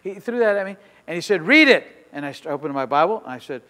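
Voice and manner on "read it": sudden loud, high command; barked order. Retold or imitated. Mean pastor orders him to read, barked order. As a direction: bark it at him like a stern pastor.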